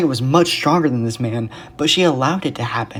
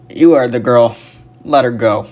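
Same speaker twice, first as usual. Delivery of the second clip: brash, loud man; brash deep voice; 8 dB louder than usual. A narrator's reading. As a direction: boom it out bluntly. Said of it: "a brash, deep voice".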